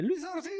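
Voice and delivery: high squeaky mock yelp; little scream. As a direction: squeal it like a frightened man. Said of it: "el gritito de Luis Ortiz". (gritito agudo imitado)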